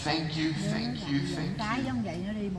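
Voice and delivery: soft, hushed thanks; gently imitated tearful whisper; retold whisper. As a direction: murmur it as if into an ear. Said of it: "she whispered in my ear".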